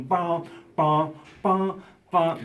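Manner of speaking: emphatic guitar hits. Retold or imitated. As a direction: punch out each guitar chord vocally. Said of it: "very emphatically on all".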